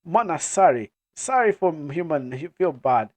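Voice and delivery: put-on apologetic African-accented clan member. Mana apologising to the human, African accent. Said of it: "racist accent, African accent".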